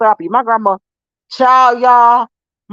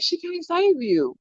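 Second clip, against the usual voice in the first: slow, softer taunt, held back; taunting her daughter hiding with grandma, softly and slowly; teasing taunt; 9 semitones higher, 8 dB quieter than usual. Retold or imitated. Mom to daughter hiding by Gigi, a soft slow taunt. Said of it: "I'd be like".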